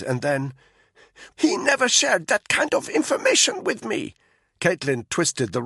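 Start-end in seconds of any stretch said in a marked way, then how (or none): 1.3-4.1 higher, strained captive woman's voice; a frightened prisoner talking under a rope; Malinka's voice. A narrator's reading.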